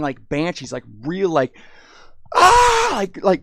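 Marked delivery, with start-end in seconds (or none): 2.3-2.9 short high shrieking scream; imitated crazy family scream; mock banshee scream. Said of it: "crazy screams".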